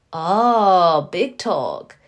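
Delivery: loud teasing "Oh, big talk"; mock teasing. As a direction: taunt the kid, playful and loud. Teasing the kid on mic.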